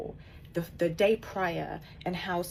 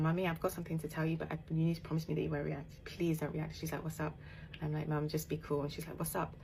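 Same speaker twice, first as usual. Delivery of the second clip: quiet; 7 dB quieter than usual. Retold or imitated. Hushed exchange with mum at tub.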